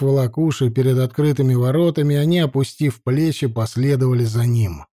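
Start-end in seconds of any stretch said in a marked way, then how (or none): none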